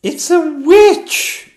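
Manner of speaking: very high, loud, slow shriek. Acted. Queen spotting the witch inside the dragon, shrieking. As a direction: scream the discovery. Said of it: "shrieked the queen".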